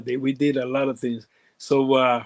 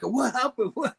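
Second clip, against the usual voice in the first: high, puzzled question; startled confusion; 10 semitones higher, 3 dB quieter than usual. Retold or imitated. Driver confused by the sudden yelling, high startled question. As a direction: ask it as if caught off guard.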